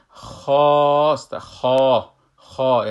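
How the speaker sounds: خا held long with a heavy kh and open back vowel; long madd. Shown as a demonstration. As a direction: stretch خا loudly, full-mouthed. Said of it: "یک کمی دراز". (خا با مد، بلند و کشیده)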